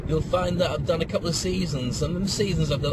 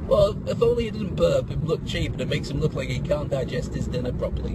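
ordinary talk, nothing marked

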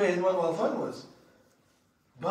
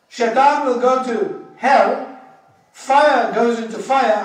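challenging question; 4 semitones higher, 10 dB louder, slower than usual. Retold or imitated.

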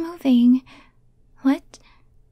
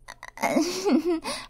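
nervous laugh; 5 semitones higher, 4 dB quieter than usual. Acted. Nervous after being caught.

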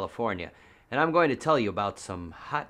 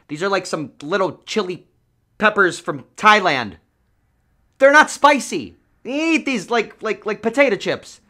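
outraged; 10 semitones higher, 10 dB louder than usual. Real, not acted. Outraged about fake pepper seeds.

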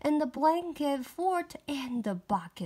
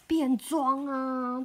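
plain reading voice

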